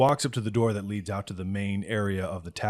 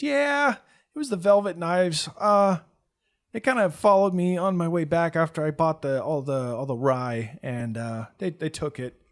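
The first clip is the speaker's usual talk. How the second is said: put-on kid explaining; 7 semitones higher, 4 dB louder than usual. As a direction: tell it haltingly.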